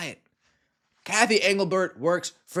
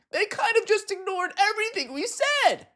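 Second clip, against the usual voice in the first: squeaky voice crack. Real, not acted. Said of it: "eh, excuse me".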